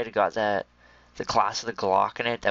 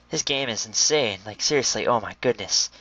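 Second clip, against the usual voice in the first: ordinary delivery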